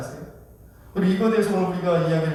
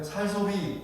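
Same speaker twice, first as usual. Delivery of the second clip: "살 소비", softer, with lax s for 쌀; Gyeongsang pronunciation; 7 dB quieter, much slower than usual. Shown as a demonstration. Preacher shows how Gyeongsang speakers say it, Gyeongsang lax s. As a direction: Gyeongsang accent that loses the tense ss in "살". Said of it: "이렇게 되잖아요".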